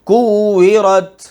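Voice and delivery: کویرت slow and firm, the doubled و held; tajweed recitation. Shown as a demonstration. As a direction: stretch out کویرت, leaning on the shadda. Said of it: "جی". (کویرت کو آہستہ، واو پر سختی سے)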